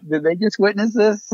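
high, alarmed embarrassed question; mock panic about the neighbors watching; panicked worry. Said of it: "I'm looking around like".

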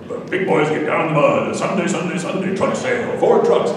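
loud, high hyped truck commercial read; monster truck ad. Shown as a demonstration. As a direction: sell it like a monster truck rally. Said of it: "I was doing a monster truck commercial".

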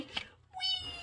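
high gliding falling whee. Acted. Grandma showing the rabbit's fall with a high whee. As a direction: slide down like a parachute ride.

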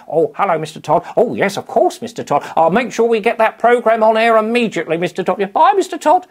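high, loud, put-on presenter voice; silly kids' TV host voice. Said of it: "He was a character that would ring up".

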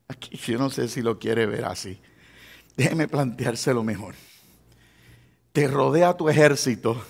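low, very soft sermon storytelling; hushed preaching. Acted. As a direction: drop your voice and confide in the congregation.

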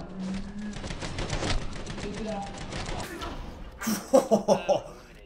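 drawn-out hearty laugh; long laughter. Real, not acted. Laughing at wild play.

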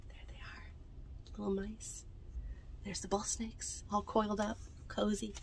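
softly, not to wake them; hushed. Real, not acted. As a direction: whisper fading to a soft murmur. Hushed aside over sleeping mice.

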